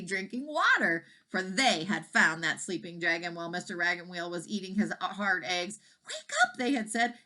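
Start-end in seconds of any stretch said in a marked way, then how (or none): 6.1-6.6 much higher cow's voice. A narrator's reading. Cows waking the baby dragon, high voice. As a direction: pipe it like a curious cow.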